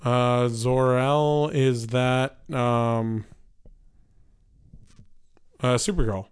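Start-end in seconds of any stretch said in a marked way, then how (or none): none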